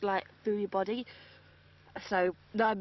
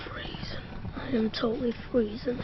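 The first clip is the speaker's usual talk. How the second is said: whispering. Real, not acted. Girl shivering in Arctic cold.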